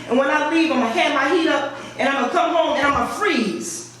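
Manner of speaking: ordinary talk, nothing marked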